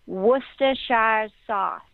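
Worcestershire sauce said slowly as WOOS-ter-shy-er sauce; careful pronunciation. Shown as a demonstration. Reading a friend the right way to say the sauce, slow and careful. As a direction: spell out Worcestershire sauce slowly, every syllable. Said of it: "It's actually pronounced".

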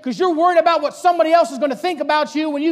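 high, loud pulpit challenge; full-force preaching. Real, not acted. Preacher confronting youths about peer pressure, full-force preaching. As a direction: press the point hard.

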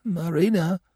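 very high, feeble call; failing voice. A narrator's reading. Gobo dying, calling Marina with failing voice. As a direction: let the name fade weakly. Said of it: "His voice failed".

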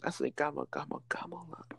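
breathy whispered chant; whispering. Pretend. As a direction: whisper the last words of the rhyme.

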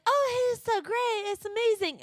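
high, gushing fake praise; fake cheerful. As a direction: gush like a two-faced friend. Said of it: "It's like".

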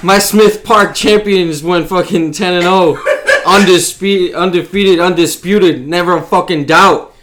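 boastful shout through laughter; loud laughing brag. Acted. Bragging loudly about kids' team.

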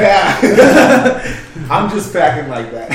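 cackling at his own joke; laughing. Real, not acted. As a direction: burst out laughing, high. Bragging and cracking up.

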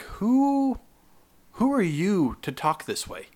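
incredulous at the staff, voice leaping up; indignant. Retold or imitated. Reacting to event staff mocking them, high indignant.

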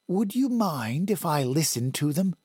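a hint of an older woman, questioning; woman's voice. A narrator's reading. Woman character speaks.

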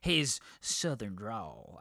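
slow, lightly drawn-out southern drawl try; attempted southern drawl. Shown as a demonstration. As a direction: stretch the words in a mild Texas drawl. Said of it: "I can't do a good, like, southern drawl".

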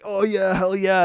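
loud eager hype; hyped bro. Retold or imitated. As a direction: pump it up like a bro. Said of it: "and you're like".

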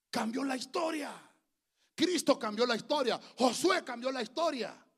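high, loud proclamation; emphatic preaching. Real, not acted. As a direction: proclaim it forcefully to the congregation. (proclamación enérgica y en voz alta)

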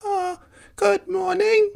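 put-on sweet old neighbour creaking a hello; creaky old lady. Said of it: "creaked Miss Crickety".

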